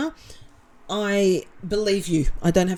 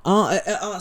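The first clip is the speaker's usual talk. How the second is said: usual speaking voice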